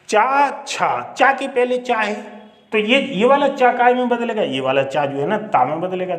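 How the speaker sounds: usual speaking voice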